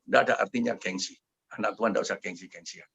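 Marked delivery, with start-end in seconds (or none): none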